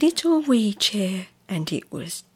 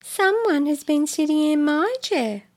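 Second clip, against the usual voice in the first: mama bear voice; 7 semitones higher than usual. Acted. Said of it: "said the middle-sized Mama Bear".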